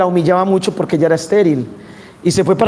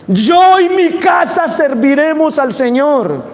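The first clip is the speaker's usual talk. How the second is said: proclaimed shout; 7 semitones higher, slower than usual. Acted. Preacher to congregation, a slow, high, ringing shout. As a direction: call it out to the whole congregation.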